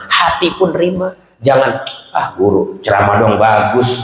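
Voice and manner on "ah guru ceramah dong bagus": low, slow hollow compliment; mock praise. Pupil falsely praising teacher, low slow compliment. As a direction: praise it slowly, only on the surface.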